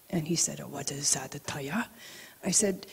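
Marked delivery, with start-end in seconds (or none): 0.6-1.9 hushed, low and gentle question; soft whispered teacher. Retold or imitated. Rinpoche gently asks his student, hushed and kind. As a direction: ask it barely above a whisper, kindly.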